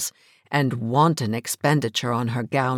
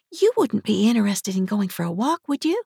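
higher, rising tentative question; hopeful shy ask; 8 semitones higher than usual. A narrator's reading. Kitty shyly asks Sammy to go walking. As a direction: ask hopefully, half afraid.

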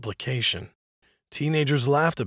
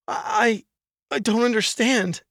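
frightened stammer; 9 semitones higher, 3 dB louder than usual. Acted. Terrified man stammers.

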